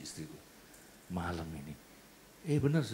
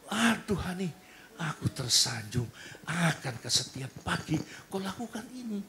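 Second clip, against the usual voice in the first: gushing wife; 6 semitones higher, 4 dB louder than usual. Acted. Wife gushing over breakfast.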